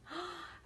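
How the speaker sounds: huge inhaled breath; big gasp. Acted. Wolf drawing breath.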